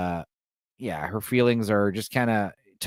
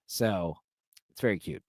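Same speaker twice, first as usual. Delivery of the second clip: normal talking voice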